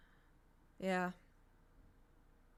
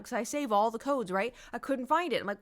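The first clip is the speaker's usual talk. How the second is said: ordinary talk, nothing marked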